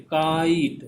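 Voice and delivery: काइट drawn out as "kaa-eet"; काइट with long "aa" sliding into "ee"; model pronunciation. Shown as a demonstration. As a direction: say काइट clearly for students to copy, both vowels heard. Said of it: "अपने मुंह से बोलिए".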